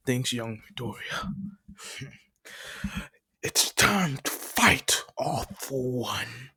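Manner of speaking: slow, solemn hero voice; a touch of grand resolve; mock heroic declaration. All Might thanks Midoriya, slow and heroic. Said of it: "All Might's like".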